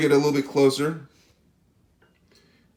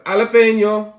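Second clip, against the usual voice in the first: higher-pitched Spanish-style jalapeno; Spanish-accented jalapeno, pitched up; 6 semitones higher, 5 dB louder than usual. Shown as a demonstration. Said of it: "Sorry for my Spanish accent".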